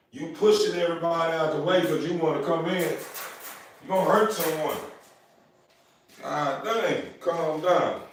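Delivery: high pet-talk scolding; talking to the dog. Real, not acted.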